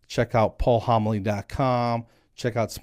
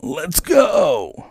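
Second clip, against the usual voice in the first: high, loud mimicked yell; imitated panicked yell; 9 semitones higher, 8 dB louder than usual. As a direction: yell it out like the son freaking out. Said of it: "he's yelling".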